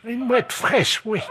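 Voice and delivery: put-on high-pitched old lady; squeaky elderly woman on the phone. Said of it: "passer le téléphone à la dame".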